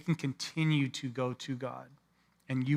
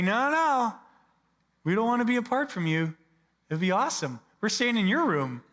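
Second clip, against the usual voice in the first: gushing fan; 6 semitones higher, 4 dB louder than usual. Retold or imitated. Congregation voiced crashing retreat.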